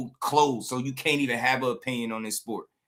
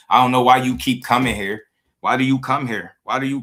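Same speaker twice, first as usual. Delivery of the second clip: louder, fed-up demand at the troll; angry, loud; 6 dB louder than usual. Real, not acted. To a troll in his chat, loud and angry. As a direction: bark it at him, exasperated.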